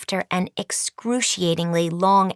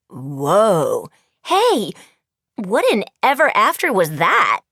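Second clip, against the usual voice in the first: startled students; 4 dB louder, slower than usual. A narrator's reading. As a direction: cry out in shock at the tremor.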